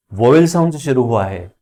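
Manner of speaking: ordinary talk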